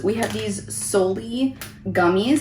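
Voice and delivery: ordinary talk, nothing marked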